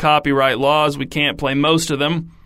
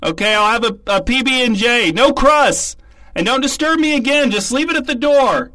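annoyed yelling; 8 semitones higher than usual. Acted. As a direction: snap at mom to leave. [name] yells at mom offscreen.

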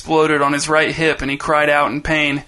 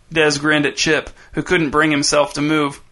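ordinary delivery, nothing marked

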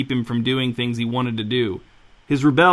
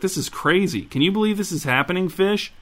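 excited; 7 semitones higher than usual. A narrator's reading. Thrilled at the murder plan.

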